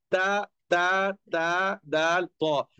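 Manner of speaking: ता दाल तो, each sound said slowly apart; tongue tip to the root of the front teeth for ता दाल तो; letter sounds demo. Shown as a demonstration. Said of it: "मैंने बताया ता, दाल, तो". (ता, दाल, तो धीरे धीरे अलग अलग)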